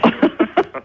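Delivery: high, quick real chuckle; amused laugh. Real, not acted. Guest reacts to host's priest joke, amused laugh. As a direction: burst into a short laugh.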